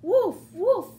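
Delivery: loud barked woof like a puppy; loud playful puppy woofs; dog bark. Acted. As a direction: bark it out like Biscuit. Dog barks in story.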